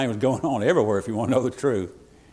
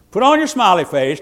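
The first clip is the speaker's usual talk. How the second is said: wife's reminder; 7 semitones higher, 7 dB louder than usual. Retold or imitated. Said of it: "she'd say".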